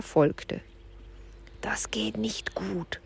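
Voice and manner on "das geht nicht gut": breathy slow whisper, much softer; slow whispered worry; murmured. Acted. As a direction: whisper the doubt under your breath. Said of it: "murmelte Julian".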